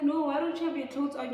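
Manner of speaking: loud, high, fast demand; alarmed scolding. Retold or imitated. Woman confronting the undressed guy, alarmed scolding. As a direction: confront him, loud and rushed.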